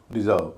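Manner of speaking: "Reserve" with a light first syllable and heavy "जर्व"; stress on second syllable. Shown as a demonstration. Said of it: "दूसरे syllable पर stress है". ("Reserve" में दूसरे syllable पर ज़ोर, पहला हल्का)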